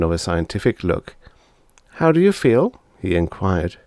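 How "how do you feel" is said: put-on high curious boy; a little boy asking eagerly; boy's voice. Brother checking on his sister, high curious boy. Bob's lines in story.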